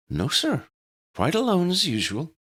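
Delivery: head teacher voice. A narrator's reading.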